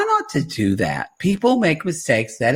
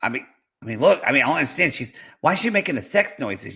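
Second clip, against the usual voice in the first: normal talking voice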